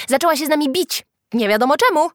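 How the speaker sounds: high, loud complaint; tattling. A narrator's reading. Freckled boy accuses Julka to teacher, tattling. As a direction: complain loudly, pitched high. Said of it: "naskarżył piegowaty chłopak".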